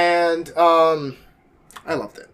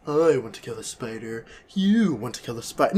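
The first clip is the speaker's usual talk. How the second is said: soft, human villain read; Eddie Brock voice; 6 semitones lower, 6 dB quieter than usual. Retold or imitated. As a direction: say it limply, in an everyday human voice. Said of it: "That's much better than".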